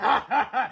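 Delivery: high, gleeful villain laugh; gloating laugh. A narrator's reading. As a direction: laugh in triumph at the boys. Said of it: "laughed Mister Krupp".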